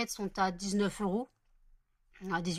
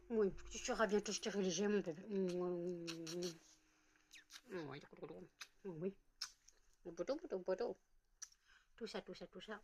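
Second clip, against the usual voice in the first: soft cooing; 9 dB quieter, faster than usual. Real, not acted. To the dog about her upcoming spaying, soft cooing. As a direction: lull her with quiet sweet nothings.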